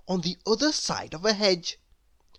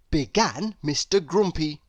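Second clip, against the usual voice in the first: plain reading voice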